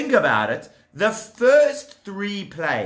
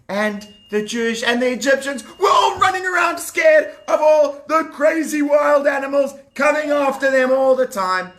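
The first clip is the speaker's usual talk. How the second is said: loud, higher frantic storytelling; excited; 9 semitones higher, 5 dB louder than usual. A narrator's reading. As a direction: call it out like everyone's panicking. Frantic retelling of fleeing animals.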